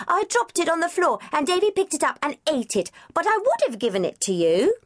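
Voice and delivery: put-on high child's voice, sulky; disgruntled little girl. A narrator's reading. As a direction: complain like a put-out five-year-old. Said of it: "Debbie looked disgruntled".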